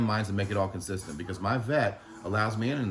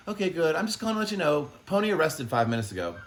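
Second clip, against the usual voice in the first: much higher and louder, sing-song mock of a chipper caller; mocking the doctor's voice; 9 semitones higher, 4 dB louder than usual. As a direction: parody her bright phone manner, pitched way up. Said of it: "this is how she sounded".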